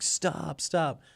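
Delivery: high, urgent call to stop; high alarmed plea to halt; panicked stop. Retold or imitated. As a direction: cry out for them to stop. Yelling at bandmate to stop van.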